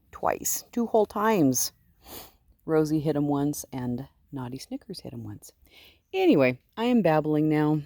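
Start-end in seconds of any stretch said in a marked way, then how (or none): 3.7-5.5 soft whispered aside. Real, not acted. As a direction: drop to a whisper.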